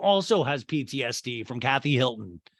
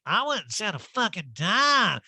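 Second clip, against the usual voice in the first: high, slow mock-outraged drawl; hammed-up indignant impersonation; Erica; 7 semitones higher, slower than usual. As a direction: stretch it out as a swooping caricature. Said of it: "And Erica's like".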